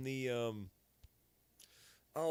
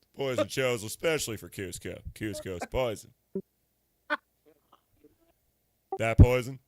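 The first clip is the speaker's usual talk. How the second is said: loud, low, dramatic villain; movie villain voice; 4 semitones lower, 8 dB louder than usual. Retold or imitated. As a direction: ham up the villain's poison line. Emperor's New Groove quote.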